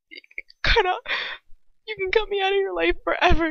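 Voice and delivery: high, pleading, choked with tears; tearful. A narrator's reading. Hermione begging Ron to let her explain, tearful. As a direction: beg desperately, crying as you go. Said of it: "tears streaming down my face".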